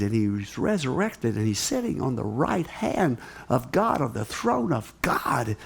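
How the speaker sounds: usual speaking voice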